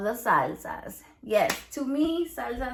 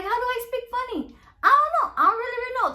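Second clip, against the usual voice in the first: much higher, quick, indignant squeal; mock indignant; 12 semitones higher, 6 dB louder, much faster than usual. Vlogger asking people why she speaks funny, high and indignant. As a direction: bounce the pitch way up, playing baffled. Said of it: "I'm like".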